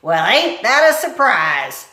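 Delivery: loud, slow sarcasm; sarcastic cook. Acted. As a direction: drag it with scorn. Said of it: "said Cook".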